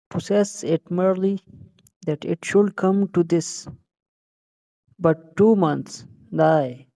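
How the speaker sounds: slow, halting soliloquy with long pauses; emphatic, drawn-out stage recitation; reciting Hamlet. Acted. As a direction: declaim it slowly, punching short phrases. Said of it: "तो शुरू करें फिर Hamlet की first soliloquy".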